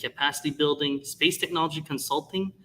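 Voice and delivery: ordinary talk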